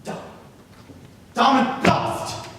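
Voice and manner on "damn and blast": put-on loud English-accented curse. Pierre cursing after his scheme fails, very English accent. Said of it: "That sounded very English".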